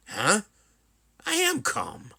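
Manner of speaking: high, indignant insistence; touchy, worked-up retort; agitated denial. Acted. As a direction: protest with pitch shooting up. Acted heated denial of anger.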